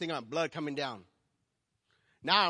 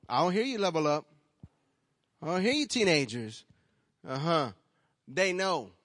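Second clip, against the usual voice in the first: calling out to the crowd; 3 semitones higher, 9 dB louder than usual. Real, not acted. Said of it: "calls out to teenagers".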